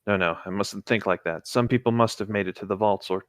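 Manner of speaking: a lightly acted trapped survivor, reading steadily; reading a Fallout lore log aloud to a friend, somber. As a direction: read the survivor's diary entry gravely.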